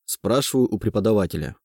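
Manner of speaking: plain reading voice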